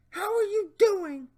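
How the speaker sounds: high mock leer; Jeff Stacy voice. Reading the predator's question, high mocking voice. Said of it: "my Jeff Stacy voice".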